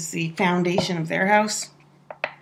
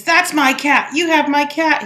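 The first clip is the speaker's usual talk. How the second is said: put-on a kid's sassy claim, higher and louder; 5 semitones higher, 4 dB louder than usual. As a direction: mimic a cheeky eight-year-old accusing. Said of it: "pretty sassy".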